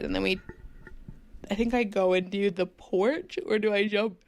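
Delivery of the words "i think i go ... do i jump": yawning. Real, not acted. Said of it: "I'm yawning again".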